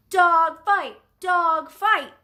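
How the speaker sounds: high, loud excited yell. A narrator's reading. Boy announces the fight to onlookers, yelling. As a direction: yell it out to the whole street. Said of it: "one boy yelled".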